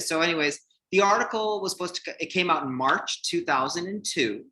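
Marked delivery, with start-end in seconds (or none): none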